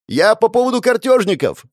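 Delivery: high, loud shout. Acted. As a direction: yell it at a hard-of-hearing old woman. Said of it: "прокричал Костенко".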